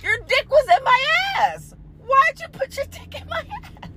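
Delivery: high, indignant squealing demands; furious disbelief at him; outraged squeal. Retold or imitated. Confronting her partner, high indignant squealing.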